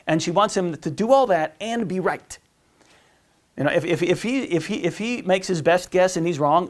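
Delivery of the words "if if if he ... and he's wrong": usual speaking voice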